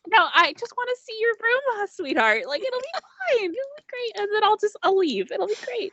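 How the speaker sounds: very high, sugary mom voice; high-pitched fussing mother; placating mother. Acted. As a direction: brightly reassure your child while insisting. Mother placates her embarrassed child.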